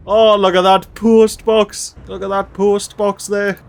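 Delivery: delighted gushing. Real, not acted. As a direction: savour every word like a fan. Spotting a post box in game.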